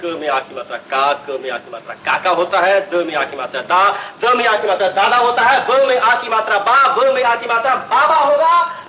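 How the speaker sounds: spelling syllables aloud: क में आ की मात्रा का; sounding out words. Acted. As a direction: build each syllable then the word काका. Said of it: "अब आप देखिए". (अक्षर जोड़कर पढ़ते हुए)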